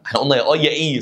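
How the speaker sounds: usual speaking voice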